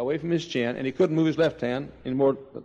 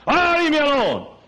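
high, louder, irritated dismissal; mock brush-off; 13 semitones higher, 5 dB louder than usual. Drunkard brushing off his pleading sister, loud and irritated. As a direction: wave the sister off in annoyance. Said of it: "why don't you get saved tonight".

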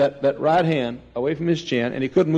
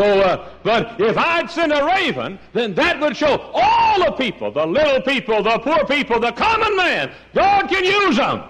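rising preaching shout; 11 semitones higher than usual. Real, not acted. Preacher to congregation, about God using common people, a rising shout. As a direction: build it up until you're shouting from the pulpit.

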